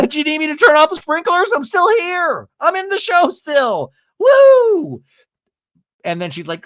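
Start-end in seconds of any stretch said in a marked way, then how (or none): 0.1-5.0 very high, eager impression; hammed-up chirpy offer ending in a loud whoop; Elizabeth Vargas. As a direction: pop out hollering to be noticed. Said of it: "Elizabeth Vargas should pop out and go".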